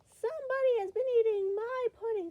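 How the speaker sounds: high, loud middle-sized bear voice; Mama Bear. A narrator's reading. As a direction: pitch it well up, complaining loudly. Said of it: "in her middle-sized voice".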